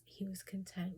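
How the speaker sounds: ordinary talk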